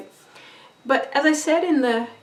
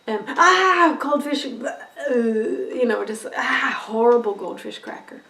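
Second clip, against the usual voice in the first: disgusted, slow, with a laughing groan; hammed-up mock disgust; mimed disgust; slower than usual. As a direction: recoil from the horrible goldfish cracker. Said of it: "mimed toward the goldfish crackers".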